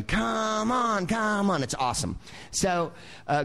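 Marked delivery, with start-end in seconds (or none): 0.0-1.6 high, mock-swaggering glam rocker egging on; hammed-up high come-on to the crowd. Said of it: "and he's going".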